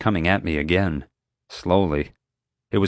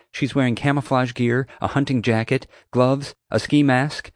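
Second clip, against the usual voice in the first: lightly voiced higher-pitched character; higher, slowing on the last items; reporting a description; 6 semitones higher than usual. A narrator's reading. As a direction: list the items for a dispatcher. Summer reporting to the dispatcher.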